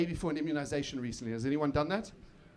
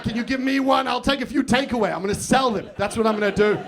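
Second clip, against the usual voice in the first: loud, higher mock voice of his partner; mock greedy wife; 4 semitones higher, 5 dB louder than usual. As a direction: ham up a grasping partner, louder. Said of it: "She didn't actually say it like that".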